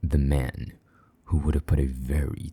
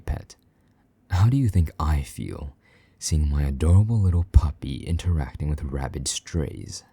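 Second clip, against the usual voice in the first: normal talking voice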